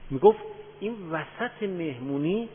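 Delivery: ordinary talk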